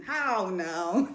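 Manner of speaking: ordinary talk, nothing marked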